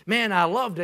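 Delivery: ordinary talk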